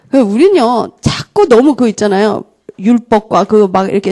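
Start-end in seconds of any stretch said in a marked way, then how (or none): none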